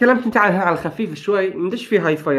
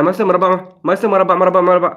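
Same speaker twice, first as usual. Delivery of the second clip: "مربع" rattled off with no gaps, pushed louder; rushed button presses; 4 dB louder than usual. Shown as a demonstration. Gamer showing co-host the wrong timing, rushed and insistent. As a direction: repeat "مربع" in a hurried, off-beat run. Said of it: "لا، لازم مربع".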